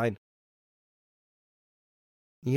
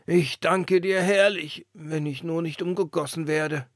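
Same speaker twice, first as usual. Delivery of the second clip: put-on old lantern voice; 6 semitones higher, 6 dB louder than usual. A narrator's reading. The old lantern amazed at the wind's gift. Lantern thanks, fears being recast.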